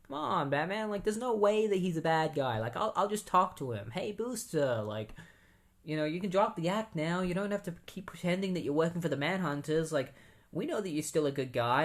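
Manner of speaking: lightly voiced, breezy Guy Gardner. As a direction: play him as easygoing and naive. Guy Gardner pleading with Batman.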